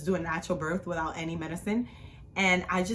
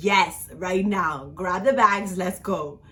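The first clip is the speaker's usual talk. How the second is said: firm, drawn-out yes, then a louder command; insistent order; 5 dB louder, slower than usual. Retold or imitated. Wife in labor orders her husband, insistent. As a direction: insist on it, no room for doubt.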